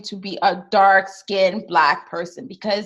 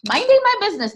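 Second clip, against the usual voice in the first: MINDING pitched way up in a sing-out; emphatic repeat; 11 semitones higher, 4 dB louder, much faster than usual. Real, not acted. Storyteller stressing she was at work "Minding my business", emphatic and high.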